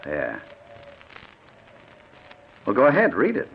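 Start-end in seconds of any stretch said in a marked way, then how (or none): 2.7-3.5 higher, louder taunting order; harsh command. Acted. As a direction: push him hard to read. Kidnapper forces victim to read.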